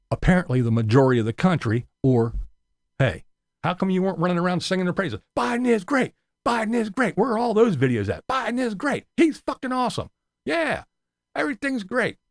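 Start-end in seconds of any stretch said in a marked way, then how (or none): 3.0-5.2 high, fast, sneering mock voice; mocking whiny critic. 5.4-12.2 very high, sing-song mock praise; sarcastic gushing. Mocking fake praise.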